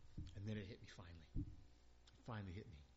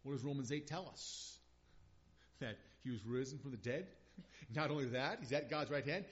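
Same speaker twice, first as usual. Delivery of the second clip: usual speaking voice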